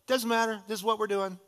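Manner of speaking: lightly voiced headstrong daughter; determined daughter. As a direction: wave off the worry. Daughter brushing off parents.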